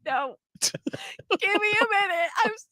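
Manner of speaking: retold crying. Mom asking for a minute, crying. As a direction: re-enact speaking through tears, stretched out. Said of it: "And I'm crying and I'm like".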